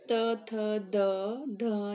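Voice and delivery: usual speaking voice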